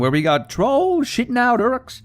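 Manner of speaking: high, squeaky comic voice; silly high voice. Pretend. Gamer joking about orcs in a squeaky high voice. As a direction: keep the goofy falsetto going.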